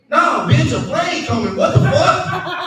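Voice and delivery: loud, high alarmed outburst; mock panic. Office man warning lover of a plane, loud mock panic. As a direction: shout it in comic alarm, rising at the end.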